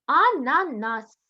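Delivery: Ананас stretched out very slowly; teacher models the word slowly; slow syllable-by-syllable. Shown as a demonstration. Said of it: "де місце букви С".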